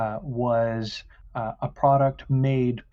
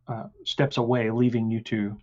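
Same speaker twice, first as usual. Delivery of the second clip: normal talking voice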